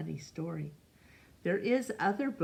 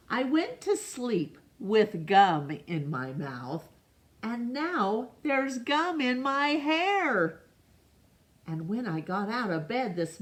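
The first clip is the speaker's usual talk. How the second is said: storybook narration with rising dismay; reading to children; 6 semitones higher than usual. A narrator's reading. Teacher reading aloud to her class, a child's woe. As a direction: tell it like a kid whose day went wrong.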